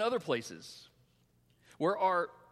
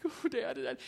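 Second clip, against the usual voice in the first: high-pitched mock-scared little girl; frightened child; 8 semitones higher, slower than usual. Daughter sees the needle and calls dad, frightened. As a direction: imitate a daughter appealing to dad.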